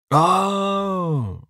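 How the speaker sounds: high drawn-out oh, realising; lanky one slowly catches on; lanky neighbour. A narrator's reading. Neighbour to Uva, a high, drawn-out oh. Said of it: "says the lanky one with a nod".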